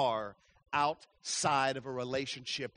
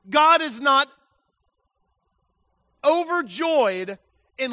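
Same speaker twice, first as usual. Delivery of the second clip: fiery preaching; 8 semitones higher, 10 dB louder than usual. Acted. Pastor urging sinners in full-force preaching. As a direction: preach it hard to the congregation.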